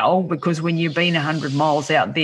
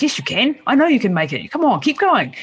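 higher-pitched cheering-on voice; encouraging inner voice; 4 semitones higher than usual. Acted. Supportive inner voice answering the negative one, encouraging. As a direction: urge them on like an inner cheerleader. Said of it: "then the other one's".

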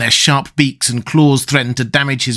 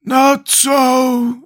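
higher, hollow exclamation; Scarecrow's head contradicting the others, hollow; 11 semitones higher than usual. Acted. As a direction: call it out hollow from the back. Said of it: "exclaimed a hollow voice".

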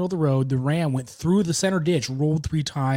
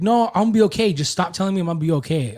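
imitating an upset child, higher and louder at first; retold tearful insistence; 4 semitones higher, 3 dB louder, faster than usual. Injured girl to her rescuers, mildly upset insistence. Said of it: "she starts crying more".

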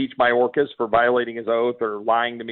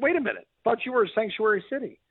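much higher, sarcastic disbelief; wide-eyed mock surprise, pitched way up; mock incredulity; 8 semitones higher than usual. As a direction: feign shock at the hypocrisy. Sarcastic retort to New York.

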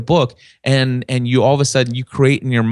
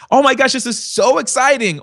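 a high, bright burst of excitement; excited gush; 12 semitones higher than usual. Retold or imitated. Podcaster showing listeners how his voice sounds excited. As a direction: gush like great news just came in. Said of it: "They actually hear when you say".

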